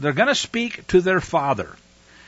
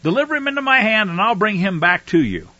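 pleading outburst; 7 semitones higher, 5 dB louder than usual. Acted. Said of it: "He says to his father".

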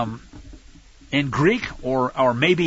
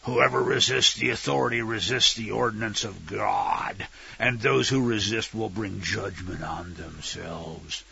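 Emperor Palpatine; 8 semitones lower, 6 dB quieter than usual. Retold or imitated. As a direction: intone it like a dark galactic emperor passing sentence. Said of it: "I'm picturing, uh, Emperor Palpatine".

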